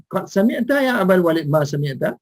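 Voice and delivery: ordinary talk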